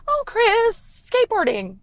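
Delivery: a sweet small mom calling her son; mom impression. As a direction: chirp it like a sweet little mom. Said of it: "actually a pretty good impression".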